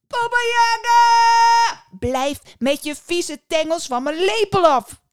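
high, very loud drawn-out yell; outraged shout. Acted. Little boy yells at the witch grabbing his spoon, outraged shout. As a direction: scream the name long, then yell the order.

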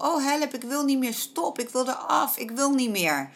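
imitating panicked child. Instructor mimics a child panicking on a pony, mock panicked high cries. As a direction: play the frightened kid who wants off the pony, a bit hammed-up. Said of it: "in paniek gaat schreeuwen".

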